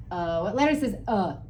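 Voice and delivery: ordinary talk, nothing marked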